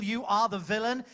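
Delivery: ordinary talk, nothing marked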